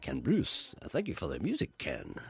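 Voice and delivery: starts very low then goes up a little; low radio-host sign-off rising slightly; Ken Bruce. Retold or imitated. Imitating Ken Bruce's low radio sign-off to the co-host. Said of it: "Ken Bruce tries to do that hum".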